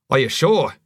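a hint of a rumbling Scottish dwarf; lightly voiced gruff Highland dwarf; rumbling mountain spirit. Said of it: "in a deep, rumbling voice".

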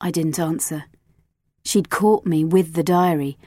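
plain reading voice

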